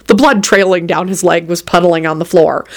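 usual speaking voice

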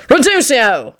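high-pitched bellowed incantation; bellowed spell. A narrator's reading. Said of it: "he bellowed at the lobster".

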